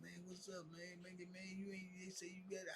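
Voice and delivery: lightly voiced ailing friend, high and soft; high, faint retold plea; sick, weak man. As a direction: imitate a weak man pleading quietly. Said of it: "he's sick and he weak".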